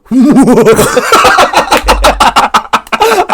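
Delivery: long, high-pitched cackle; high laugh. Real, not acted. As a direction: break into a loud, shrill laugh. Laughing at his own joke.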